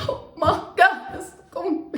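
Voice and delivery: choked-up, very high squeal; reliving the big news, squeaky and loud; emotional. Retold or imitated. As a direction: voice shoots up, overcome with emotion. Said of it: "I'm actually emotional now".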